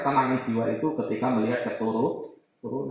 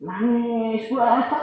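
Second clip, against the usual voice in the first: high, mock child wail; lightly hammed-up crying child; imitating a crying child; 10 semitones higher, much slower than usual. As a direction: whine like a little kid throwing a fit. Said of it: "anak nangis".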